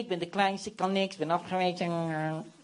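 soft mewling self-pity, trailing into a whine; imitating a feeble complainer; mock whining. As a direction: whimper excuses like a mewing cat. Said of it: "En lopen te miauwen".

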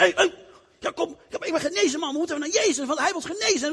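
high, laughing, excited rush; bursting with joy, urging the others. Acted. Healed leper urges his friends back to Jesus, high and excited.

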